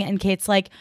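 ordinary delivery

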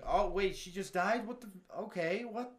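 high-pitched, baffled reaction; retold upset confusion. Viewer recalling the heroine's sudden death, upset and baffled. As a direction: act out being thrown, scrambling for words. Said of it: "upset of".

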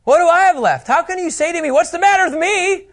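hammed-up victim pleading; outraged Micah. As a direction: Micah protesting to the Danites. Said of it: "So Micah says".

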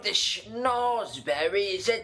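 high, slow, theatrical gentleman voice; posh Wonka impression. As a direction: savor it like an eccentric candy maker. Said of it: "The schnozberries taste like schnozberries".

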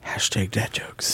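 soft slow whisper; whispered aside. Pretend. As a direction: breathe it as a whispered joke.

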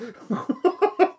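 high burst of laughter; incredulous laugh. Real, not acted. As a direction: laugh in disbelief. Laughs between outbursts.

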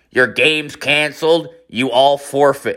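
playful field announcement, each phrase stretched; mock bullhorn announcement. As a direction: announce it drawn out, as if over a bullhorn. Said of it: "like, the fucking microphone".

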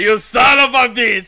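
hammed-up action-movie shout; mock shout. Repete o grito do filme. (gritando como no filme, de brincadeira)

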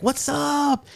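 high, hollered-back hello; excited greeting. Retold or imitated. Calling back to cousins.

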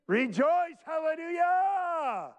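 high, drawn-out cry of praise; jubilant shout. Real, not acted. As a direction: shout it in triumph, holding the last word long. Preacher answers his own call to rejoice.

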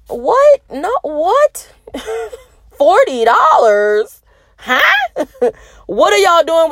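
high, squeaky stunned what; mock disbelief. Reacting to a forty-dollar offer, in mock shock. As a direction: act floored by the offer.

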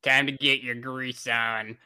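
gruff cafeteria lady; lightly voiced bossy lunch lady. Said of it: "You gave her lunch lady voice".

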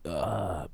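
low, quiet "ugh"; retold soft groan. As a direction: let out a small, low mock groan. Retold reaction, not my fault.

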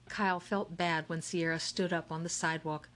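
usual speaking voice

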